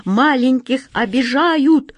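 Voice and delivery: high, loud, slow outraged chant; indignant cry. A narrator's reading. The mice rally against the cat, high loud outraged cry. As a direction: shout it out stretched like a rallying cry. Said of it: "подхватили все остальные мыши".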